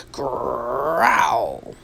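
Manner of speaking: low growling noise; a low animal growl. A narrator's reading. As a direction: growl like a hidden beast. Strange noise in the night.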